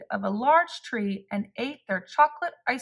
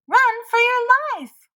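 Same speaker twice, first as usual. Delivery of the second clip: panicked shout; 13 semitones higher, 7 dB louder than usual. A narrator's reading. Rabbit panics at the monster.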